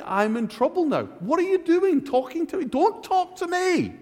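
high, frightened pleading, slowing at the end; imitated fear. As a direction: act out Mary's panic, a touch hammed-up. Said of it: "It was that kind of fear".

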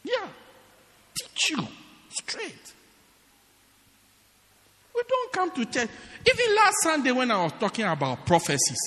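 ordinary delivery

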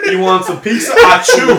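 high, flirty, then a sneeze; sleazy sweet talk; creepy flirting voice. Pretend. Acting stepdad flirting, sneezing.

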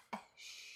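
soft breath of "shh"; mimicked shush. Reader mocking Calvin's finger to lips, mimicked shush. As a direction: imitate his hushing under the breath.

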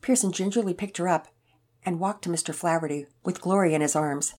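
plain reading voice

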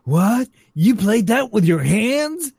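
put-on high, slow disbelieving kid; amazed kid. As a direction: gawk like a future kid at old tech. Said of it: "like Back to the Future two".

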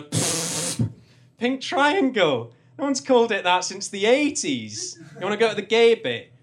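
the driver mocking his old-fashioned name for the bar; scoffing taxi driver.

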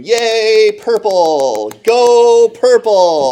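high, loud, drawn-out cheer from the stands; mock mom cheer. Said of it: "my mom in the stands going".

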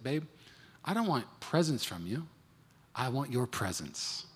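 soft, slow heartfelt line; softly telling his wife what he wants; tender plea. Retold or imitated. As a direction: say it gently, meaning it.

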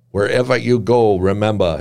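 put-on courtly Piedmont accent, putting on a drawl. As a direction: drawl it like a debonair Piedmont editor. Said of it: "he drawled".

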